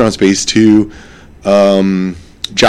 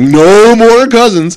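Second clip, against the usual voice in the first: high, slow, louder refusal; exasperated Jack; 8 semitones higher, 4 dB louder, much slower than usual. Retold or imitated. Jack refusing Felipe's next cousin, exasperated drawn-out outburst. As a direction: put a firm stop to it. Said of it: "And Jack's like".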